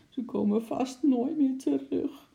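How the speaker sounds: put-on high, tearful child's voice; sad sobbing. Lotje fearing her pets are lost, sobbing sadly. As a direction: whimper it, acting out a child's sobs. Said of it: "snikte ze verdrietig".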